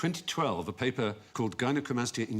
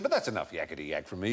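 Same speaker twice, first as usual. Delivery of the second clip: Australian flight announcer; 8 semitones lower, faster than usual. Retold or imitated. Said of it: "It's usually Australians".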